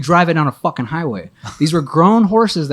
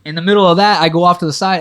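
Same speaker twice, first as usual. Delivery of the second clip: ordinary talk, nothing marked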